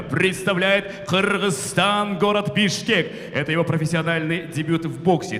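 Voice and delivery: booming ring announcer intro; boxing ring announcer. Acted. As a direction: announce the fighter to the arena.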